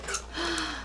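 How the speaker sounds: soft, airy storybook gasp; mock surprised gasp. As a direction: gasp softly in pretend surprise. Mock surprise before each reveal.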